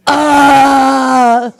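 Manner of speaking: hammed-up sustained cry of pleasure; loud mock moan.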